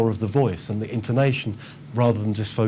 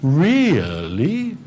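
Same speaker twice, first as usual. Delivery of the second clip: exaggerated intonation; 5 semitones higher, 4 dB louder than usual. Shown as a demonstration. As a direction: overdo the melody of "Really?" so students notice it.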